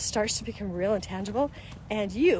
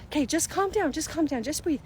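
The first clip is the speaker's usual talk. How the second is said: put-on soothing a child; 7 semitones higher than usual. Parent calming child.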